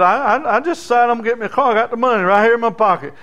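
fast, high, stammering boast; eager boy. Acted. Boy hurrying to tell the preacher about his money, fast and high. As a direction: rush it out, proud of the money.

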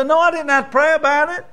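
high, brushing-off reply; cocky church member. Retold or imitated. Church member answering the preacher with a high, dismissive tone. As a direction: wave off the need to pray.

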